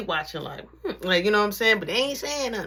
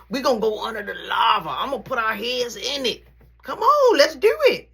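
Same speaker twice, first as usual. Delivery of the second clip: escalating shout; 3 semitones higher, 3 dB louder than usual. Real, not acted. Threatening the bully in the hallway, escalating shout.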